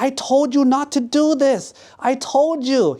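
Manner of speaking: high-pitched indignant outburst; furious scolding. Acted. Girl just dunked in water, furious at the prankster. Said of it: "the girl was so mad".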